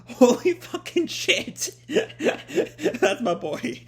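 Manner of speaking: laughing, high and loud with delight; excited laughing. Real, not acted. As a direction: cheer through real laughter. Thrilled at Chopper suplexing Queen.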